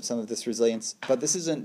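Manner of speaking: normal talking voice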